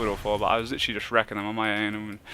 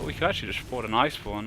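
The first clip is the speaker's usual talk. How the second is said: normal talking voice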